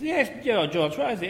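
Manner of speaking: pompous under manager answering; mocking manager voice. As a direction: voice the pompous boss.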